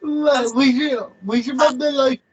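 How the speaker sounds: high-pitched, teary laughing words; crying with laughter. Real, not acted. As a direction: squeak it out through tears of laughter. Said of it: "I'm crying now".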